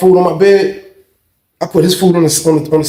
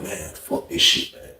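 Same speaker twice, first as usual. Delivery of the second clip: slow, low, soft whisper; fed up under-breath whisper; 7 semitones lower, 11 dB quieter, slower than usual. Retold or imitated. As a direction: hiss it to yourself.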